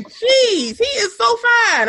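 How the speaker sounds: high, slow swooning gush. Retold or imitated. Friend swooning over Odell, high gushing voice. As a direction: gush like a smitten fan. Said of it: "It's like".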